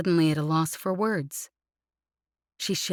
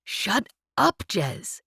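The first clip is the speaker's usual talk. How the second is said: higher, sharp angry "Shut up"; furious snap; 4 semitones higher, much slower than usual. Acted. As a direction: snap "Shut up" at her in fury, higher pitch. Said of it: "he snapped furiously".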